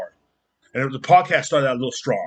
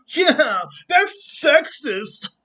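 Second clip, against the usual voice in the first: high, very slow whiny mock; whiny critic; 12 semitones higher, much slower than usual. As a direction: stretch it into a wounded whine. Mocking an offended critic.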